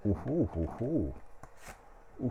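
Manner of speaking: normal talking voice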